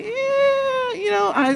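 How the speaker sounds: squeaky high, shrugging pretend voice of God; mock casual God. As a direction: toss it off like a bored deity changing plans. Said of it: "God's gonna say".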